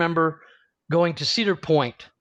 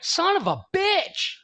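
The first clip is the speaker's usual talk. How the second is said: high, slow mock curse; acted exasperation, pitched way up; exasperated outcry; 14 semitones higher, slower than usual. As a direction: retell it as a drawn-out cry of dismay. Acted dismay at being recognized.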